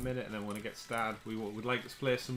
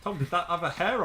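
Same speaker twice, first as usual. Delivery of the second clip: loud; 6 semitones higher, 7 dB louder than usual. Real, not acted. Teasing friend about dropped crisp.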